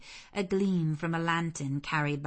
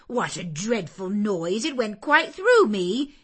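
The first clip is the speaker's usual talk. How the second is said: high, loud, rattled complaint; exclaiming; 4 semitones higher, 9 dB louder than usual. Acted. Abbott complaining about the girl's scream, exclaiming loudly. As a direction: cry out, shaken by the noise. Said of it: "exclaimed Abbott".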